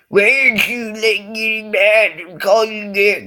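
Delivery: high, loud, stretched wail; mock exasperated bully. Bully baffled that insults fail, mock exasperation. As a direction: wail it in hammed-up frustration. Acted bully losing his temper.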